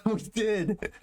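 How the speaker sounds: high, giggly laugh through words. Real, not acted. Laughing agreement with co-host.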